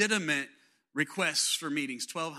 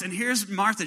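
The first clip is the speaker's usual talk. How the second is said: usual speaking voice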